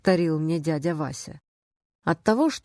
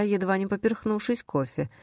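ordinary delivery

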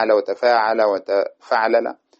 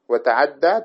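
normal talking voice

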